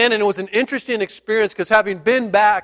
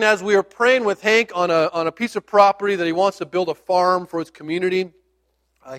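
ordinary delivery

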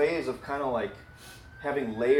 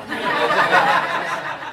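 loud embarrassed laugh; 6 semitones higher, 12 dB louder than usual. Real, not acted. Pastor laughing at himself in front of the church, loud airy burst. Embarrassed after accent joke.

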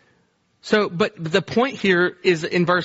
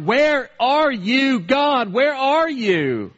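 high, slow, drawn-out cry to God; anguished call held long and high; desperate plea; 9 semitones higher, much slower than usual. Acted. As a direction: stretch each word out like a lost man calling. Preacher opens with desperate cry.

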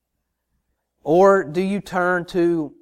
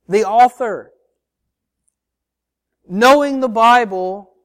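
louder, higher, driving sermon voice; impassioned preaching; 5 semitones higher, 6 dB louder than usual. Acted. Pastor urging his congregation to truly know God, fervent. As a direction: preach it out to the back pews.

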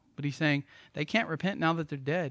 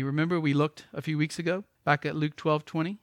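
ordinary delivery

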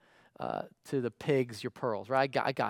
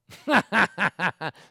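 mocking laugh; 6 semitones higher, 12 dB louder than usual. Friend laughs at crazy advice.

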